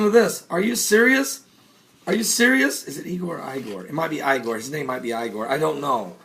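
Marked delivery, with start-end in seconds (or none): 2.9-6.2 much lower and softer, under the breath; muttered aside. Real, not acted. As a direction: mumble it to yourself. Muttered aside about name.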